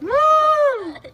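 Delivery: high loud cheer; excited whoop. Real, not acted. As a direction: whoop it out big. Cheering after the dump.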